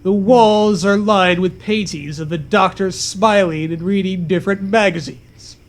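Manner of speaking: ordinary talk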